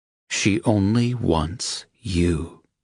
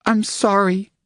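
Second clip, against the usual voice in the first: Angel voiced much higher, tearful apology; high-voiced woman apologising, choked with feeling; emotional; 14 semitones higher than usual. A narrator's reading. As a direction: break into a high emotional sorry. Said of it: "in an emotional voice".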